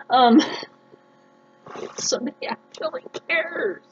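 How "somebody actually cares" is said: touched. Real, not acted. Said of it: "now I'm gonna cry".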